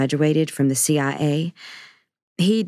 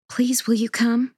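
pleading whisper; 8 semitones higher than usual. Acted. Daughter pleading.

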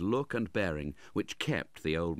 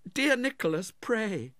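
put-on high, louder girlish plea; urging young lady; 4 dB louder than usual. A narrator's reading. Young lady begs Nicholas to stop, urging. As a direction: urge him in a high voice. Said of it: "urged the young lady".

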